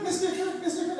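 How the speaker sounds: high, soft imitation of schoolkids; pupils pestering teacher. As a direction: call teacher in a small, high voice. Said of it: "They're like".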